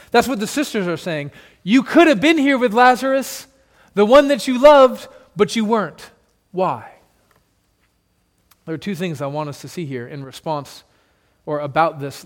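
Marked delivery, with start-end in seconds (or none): 1.6-6.9 sisters' accusation. Acted.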